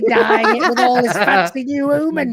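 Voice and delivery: put-on laughing Yorkshire accent, slowed. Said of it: "a thick Yorkshire accent".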